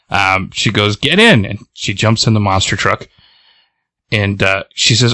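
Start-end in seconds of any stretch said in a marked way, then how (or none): 1.0-1.5 high, mildly raised acted order; urgent call. Driver to goo-covered friend at the truck, acted urgent call. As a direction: urge a friend aboard, pitched high.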